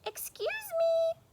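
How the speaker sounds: very high, slow little cricket voice. Acted. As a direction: play a tiny polite cricket, very high. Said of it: "coughed a cricket".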